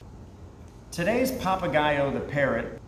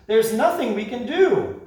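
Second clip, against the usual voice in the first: high, louder despairing wail; hopeless; 8 semitones higher, 5 dB louder than usual. Acted. Creatures despairing to the parrot, high wail. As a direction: give up in despair.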